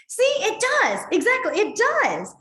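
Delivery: high, loud, triumphant agreement; excited vindication. Real, not acted. Teacher seizing on a comment that backs her, excited. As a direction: seize on the proof, pitched right up.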